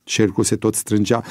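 usual speaking voice

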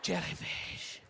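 slow, hushed trailer voice; ominous whisper. Acted. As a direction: whisper each word like a looming threat. Acts the preview's ominous list.

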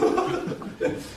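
short laugh at own joke; brief amused laughter; chuckle. Real, not acted. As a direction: chuckle briefly after the punchline.

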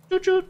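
high choo choo; train noise. Pretend. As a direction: sing it like a toy train.

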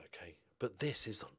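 ordinary talk, nothing marked

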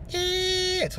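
high, slow quiet falsetto; little falsetto. Shown as a demonstration. Demonstrating the reinforced falsetto, high and slow. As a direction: float it up into a small falsetto. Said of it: "a little falsetto".

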